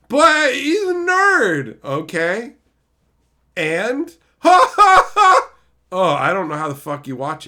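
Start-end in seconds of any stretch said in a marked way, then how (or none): 0.1-1.8 high, louder, slow mock justification; hammed-up mock defense; mock sitcom pitch. Mocking sitcom logic. 4.4-5.5 loud high canned laughter; mock laugh-track burst; fake laugh track.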